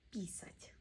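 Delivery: whispered "писать" with the stress on the last syllable; whispered repeat. Shown as a demonstration. Teacher drilling learners, whispered repeat. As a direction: breathe "писать" out with no voice. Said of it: "Practice drill".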